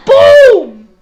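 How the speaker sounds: high, booming impact shout. Acted. Blasting a fighter out of the ring, shouted sound effect. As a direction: explode on the word.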